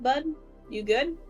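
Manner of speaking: usual speaking voice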